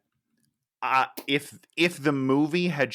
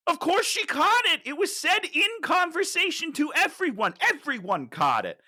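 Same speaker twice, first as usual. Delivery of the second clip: exasperated; 13 semitones higher than usual. Real, not acted. Exasperated rant at line.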